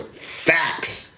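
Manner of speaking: soft, smug whisper of Facts; whispered mock "Facts". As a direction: whisper it like the commenter gloating. Mock tag on the comment.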